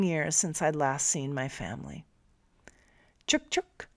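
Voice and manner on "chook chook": high coaxing call to an animal; called to the cow. A narrator's reading. Narrator coaxing the cow at the window, high call. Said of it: "I called my lady, the cow".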